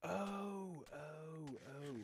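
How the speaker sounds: high sudden oh of realisation; dawning surprise, cry out; surprised. Real, not acted.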